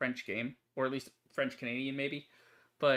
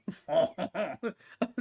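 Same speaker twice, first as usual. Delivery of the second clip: laughing; 7 semitones higher, 4 dB louder than usual. Real, not acted. Player breaking character after a dramatic vow, laughing. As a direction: burst of higher-pitched laughter.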